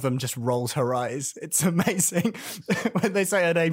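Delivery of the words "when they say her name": ordinary talk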